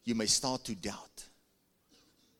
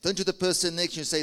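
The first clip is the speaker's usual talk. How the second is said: normal talking voice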